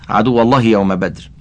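ordinary delivery

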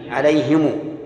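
"عليهم" read as ʕalayhimu, i after h, u after m; second reading. Shown as a demonstration. Teacher shows students the second reading of "عليهم". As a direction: say "عليهم" with kasra on ha, damma on mim. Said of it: "الثاني ... كسر الهاء وضم الميم".

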